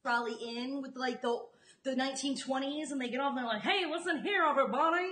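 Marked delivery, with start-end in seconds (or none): 3.6-5.1 a hint of a 1920s newsboy calling out; lightly voiced old-time newsboy hailing a crowd; Newsies voice. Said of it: "You've got your Newsies voice down".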